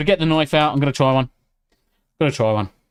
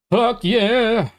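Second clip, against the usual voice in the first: excited cheer; 10 semitones higher than usual. Real, not acted. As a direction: let it burst out with joy. Same words said twice.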